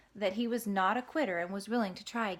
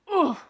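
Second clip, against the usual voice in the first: loud pained grunt on landing; 9 semitones higher, 12 dB louder than usual. Acted. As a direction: let out a hurt ugh. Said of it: "he grunted".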